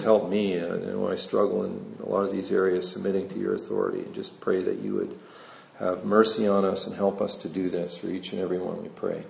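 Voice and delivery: low, subdued spoken prayer; closing prayer. Real, not acted. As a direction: pray quietly and low, winding down. Closes in prayer.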